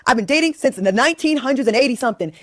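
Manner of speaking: ordinary talk, nothing marked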